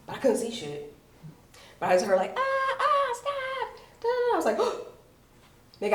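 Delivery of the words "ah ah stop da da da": high cries without extra volume; mock scream. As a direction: imitate a distant girl screaming. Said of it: "I heard a girl screaming".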